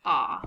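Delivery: the open sound "aw"; aw vowel sound. Shown as a demonstration. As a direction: say "aw" as in saw, on its own. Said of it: "make a special vowel sound".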